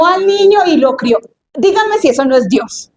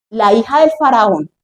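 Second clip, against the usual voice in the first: ordinary talk, nothing marked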